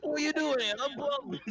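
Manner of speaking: high, loud mock British squeak; imitating British bunny. British accent, player mocking the GM's rabbit voice. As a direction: tease the GM with his British rabbit. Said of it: "went British".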